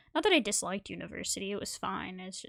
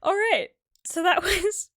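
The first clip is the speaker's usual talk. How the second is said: loud, high, slow, overtaken by laughter; real laughter bursting through the words; laughing to tears; 9 semitones higher, 10 dB louder, slower than usual. Real, not acted. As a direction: try to move on but crack up. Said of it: "I'm laughing and crying".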